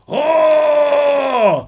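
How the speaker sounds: high, loud roar; army's roar. Retold or imitated. Soldiers answering their captain, roaring. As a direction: roar it like a whole army. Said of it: "ils crient tous".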